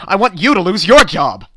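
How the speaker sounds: loud angry retort. Acted. Customer turning on the clerk, loud and angry. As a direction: fire it back at her.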